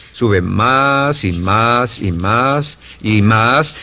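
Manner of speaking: very slow, stretching each "más"; drawn-out repetition. Shown as a demonstration. Speaker showing self-esteem climbing, slow and drawn-out. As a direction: let every "más" linger, one step after another.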